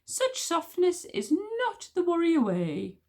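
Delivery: slow, high reproachful man's voice; stern witch smeller. Acted. Witch smeller scolding the queen, high and slow. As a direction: lecture her slowly from on high.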